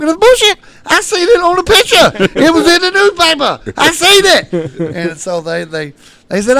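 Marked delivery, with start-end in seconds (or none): 0.0-5.0 high-pitched hysterical yelling; indignant insistence. Retold or imitated. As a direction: yell your proof as if nobody believes you. Retold frantic argument, insisting.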